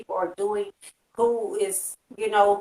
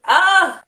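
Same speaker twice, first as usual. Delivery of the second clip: frustrated cry; 8 semitones higher, 7 dB louder than usual. Real, not acted. Venting frustration at people.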